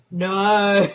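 drawn-out no climbing to a high, loud wail; mock horrified no. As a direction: wail it in melodramatic horror. Mock horror at a bad film.

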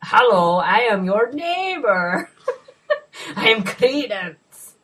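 slow, high, laughing stilted delivery; bad-movie line. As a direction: recite slowly and stiffly, nearly laughing.

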